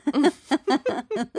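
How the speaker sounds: breaking into laughter; laughing. Real, not acted. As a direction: laugh through the word. Laughing fit at intro.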